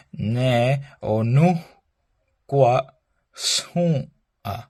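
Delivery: "Ne o nu gua sun a" slowly, syllable by syllable; careful slow breakdown. Shown as a demonstration. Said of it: "for pronunciation here is these H's".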